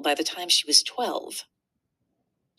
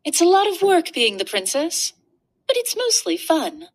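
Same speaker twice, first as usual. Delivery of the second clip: put-on much higher, brighter girl voice; proud little princess sharing a secret; 10 semitones higher, 4 dB louder than usual. Princess on her job.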